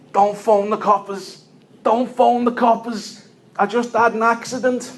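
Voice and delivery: begging passers-by not to call the police; panicked shout. Retold or imitated. Injured man begs the street not to phone police, panicked shout.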